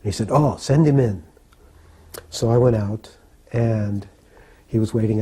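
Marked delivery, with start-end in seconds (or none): 0.3-1.2 higher, louder acted-out welcome; eager welcome. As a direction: call the guest in gladly, as if retelling it. Acting Prabhupada welcoming a visitor.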